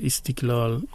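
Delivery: "استقلال" as istiklal; Azeri Turkish pronunciation. Shown as a demonstration. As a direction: say "استقلال" with i vowels and a k. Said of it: "آذری ما هم همونا رو". («استقلال» با تلفظ ترکی آذری)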